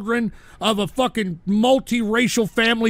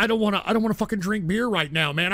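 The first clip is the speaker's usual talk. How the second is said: normal talking voice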